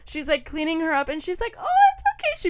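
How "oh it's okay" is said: very high cooing voice; high-pitched mock-motherly comfort; soothing mom imitation. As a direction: coo like a mother comforting a toddler.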